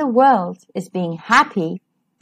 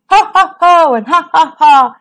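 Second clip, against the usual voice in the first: loud jeering ho ho and ha ha; mocking laugh; 4 semitones higher, 5 dB louder than usual. A narrator's reading. Classmates mocking Reg with a loud jeering laugh. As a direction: laugh at him like a bully. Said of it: "they laughed at poor old Reg".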